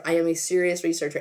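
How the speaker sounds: usual speaking voice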